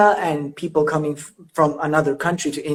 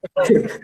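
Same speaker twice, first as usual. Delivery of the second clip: high giggle. Real, not acted. Laughing at awkward start.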